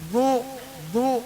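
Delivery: heavy "Du" from the tongue's edge; tongue to the side. Shown as a demonstration. Teacher drilling the letter dad, "Du" with side tongue. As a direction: set the tongue sideways for "Du". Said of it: "lidah taruh di samping".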